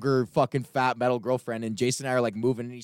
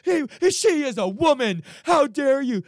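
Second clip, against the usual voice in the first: much higher, louder, prissy indignation; mock chivalrous outrage; 13 semitones higher, 4 dB louder than usual. Skinny guy defending his girlfriend, high mock outrage. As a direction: squeal it like an offended knight.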